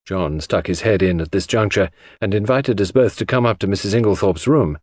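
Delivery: ordinary delivery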